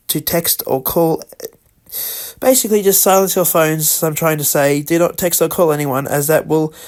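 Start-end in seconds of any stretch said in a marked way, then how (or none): none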